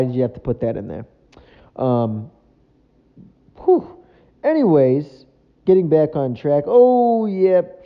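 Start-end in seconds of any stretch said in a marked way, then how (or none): 3.6-3.9 startled whew. Real, not acted. Reacts to racy film scene. 6.7-7.8 high, loud oh yep; startled by what's on screen; shocked. Real, not acted. Reacting to the western on TV, shocked.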